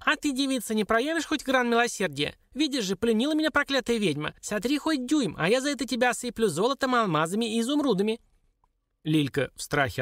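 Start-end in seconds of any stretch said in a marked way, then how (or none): 0.0-8.2 high, squeaky wheedling dwarf; high-pitched plaintive appeal; pleading dwarf. Acted. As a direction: beg for sympathy in a little imp's voice.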